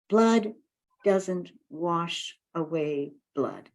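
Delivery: slow, weighty quote with a long pause after blood; deliberate, grave recitation; measured, heavy words; solemn slogan. Retold or imitated. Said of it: "the thing that they always would say".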